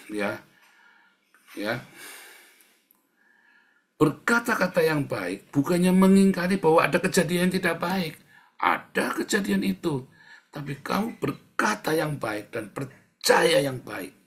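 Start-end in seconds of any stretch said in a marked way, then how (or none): 4.0-14.2 raised, high-pitched exhortation; emphatic preaching. Real, not acted. Preacher tells congregation to believe good, forceful preaching. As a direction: preach it forcefully, stressing key words.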